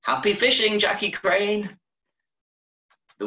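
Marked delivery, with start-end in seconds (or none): 0.0-1.8 high, bright call across the field. Acted. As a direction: call out cheerfully to the bird. Boy calls out to heron.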